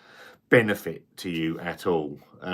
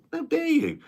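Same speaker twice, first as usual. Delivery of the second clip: hammed-up, high and pompous outrage; mock indignation. Retelling demanding his beer back, high mock outrage. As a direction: act the spoilt drinker. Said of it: "I'm like".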